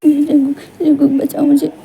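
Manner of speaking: high, tearful cry for help; frightened plea. Acted. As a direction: plead for protection from the man following you. Begs to be saved from attacker. (डरी हुई, मदद माँगती आवाज़)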